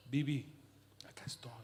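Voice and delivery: softly recalled divine reassurance; hushed inner voice. Retold or imitated. God's voice retold in the kitchen.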